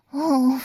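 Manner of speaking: 一声高亢的呻吟; 放开喉咙呻吟; loud moan. Acted. (响亮的呻吟)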